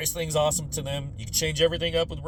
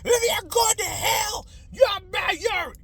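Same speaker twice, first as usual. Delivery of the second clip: much higher, louder hysterical screaming; screaming parent; 4 dB louder, slower than usual. Retold or imitated. Parents screaming at the kids about evil, high shrieking. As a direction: scream moral panic at the kids. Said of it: "Just screaming at us".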